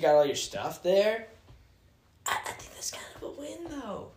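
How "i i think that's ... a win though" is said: quick whispered aside. Real, not acted. Sudden whisper in the chat.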